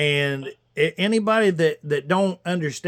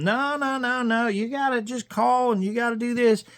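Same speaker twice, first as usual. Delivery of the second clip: higher-pitched, insistent protest; retold insistent urging; 7 semitones higher than usual. Caller retelling how he urged them all to call, insistent.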